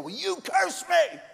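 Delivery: high, slow hammed-up shout of a quarreling husband; mock angry yell. Pastor acting out a husband fighting his wife, mock shout. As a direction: shout it back like a wounded husband. Quarreling couple retold in office.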